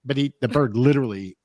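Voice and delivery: normal talking voice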